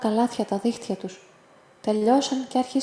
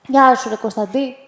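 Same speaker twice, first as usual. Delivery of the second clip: a fisherman's offhand hail, louder; man from the boat calling the boy, a touch louder; casual greeting; 2 semitones higher, 8 dB louder than usual. Acted. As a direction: call out to the boy, easy and rough.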